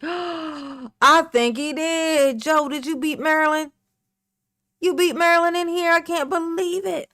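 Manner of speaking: loud, high astonishment; gasp, then exclaim it high and loud; amazed. Real, not acted. Astonished Joe beat Marilyn.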